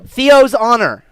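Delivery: put-on high, loud, solemn kid vow; proud young boy. Boy sealing a promise with stranger, proudly loud. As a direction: proclaim it like a boy's oath. Boy swears on shared name.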